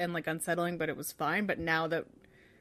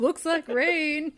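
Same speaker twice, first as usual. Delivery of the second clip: high, loud, drawn-out character quote; Da Vinci; 9 semitones higher, 7 dB louder, much slower than usual. Retold or imitated. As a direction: call it out slowly, pitched way up. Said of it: "And he's like".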